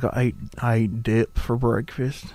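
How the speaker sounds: slow, hammed-up Southern accent; deep Southern accent. Shown as a demonstration. Host mocking own accent to producer, deep Southern accent. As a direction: play up a Southern drawl. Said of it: "that deep Southern accent".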